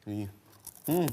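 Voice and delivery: munching a crisp fried snack; crunching chips. Real, not acted. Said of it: "바삭바삭하는 소리가 들리시나요?".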